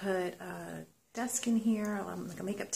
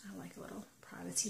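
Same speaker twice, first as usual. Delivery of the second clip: fast whisper; hushed fast whisper; 9 dB quieter, faster than usual. Real, not acted. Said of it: "I'm talking quietly".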